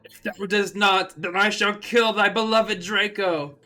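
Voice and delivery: hammed-up evil threat; villain threat. Voldemort threatening.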